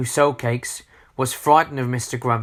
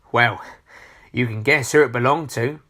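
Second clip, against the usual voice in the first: ordinary delivery, nothing marked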